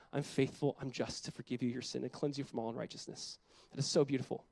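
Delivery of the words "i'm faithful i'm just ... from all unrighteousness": softer, lower and tender; gentle reassurance. Acted. God reassuring the confessing sinner, gentle. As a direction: speak it gently as comfort.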